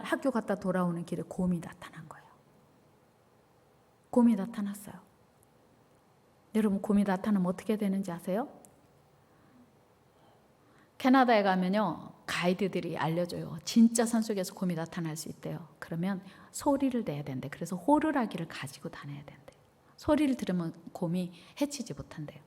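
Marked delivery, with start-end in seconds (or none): 0.0-4.9 whispered, building dread; hushed storytelling whisper; suspense whisper. A narrator's reading. Suspense: bear appears. 14.5-22.4 soft whispered aside; quiet, unvoiced telling; hushed whisper. A narrator's reading. Whispered guide advice.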